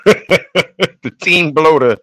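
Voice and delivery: laughing through the words; chuckling as he announces. Real, not acted.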